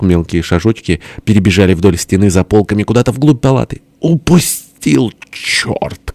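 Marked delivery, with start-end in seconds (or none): 4.0-6.1 high drawn-out cry, then a dropped curse; vexed cry. A narrator's reading. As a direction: wail at the miss, then swear under it. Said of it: "крикнул тесть с досадой". (досадливый протяжный выкрик)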